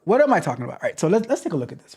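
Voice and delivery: usual speaking voice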